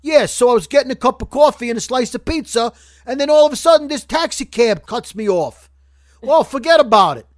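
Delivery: loud, high, hammed-up New York accent; fake New York accent. Podcaster mocking fake TV New Yorkers, exaggerated New York accent. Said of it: "the fake New York accent on television".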